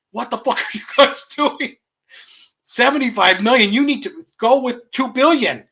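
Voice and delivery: high-pitched, hammed-up exasperation; loud mock demand, pushing the figures; mock incredulous caller. Caller challenging the space people's budget, mock incredulous.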